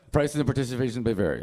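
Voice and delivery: rapid disclaimer in mock ad voice; infomercial dad. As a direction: imitate TV small print read-out. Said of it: "my dad talks like this now".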